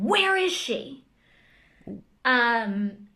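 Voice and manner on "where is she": retold yell. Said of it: "and yell again".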